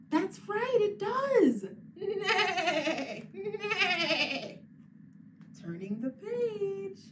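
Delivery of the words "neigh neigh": higher whinnying neigh; whinny like a pony; horse neigh. Pretend. Teacher does the blue horse, whinnying with a laugh.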